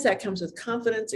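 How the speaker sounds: ordinary delivery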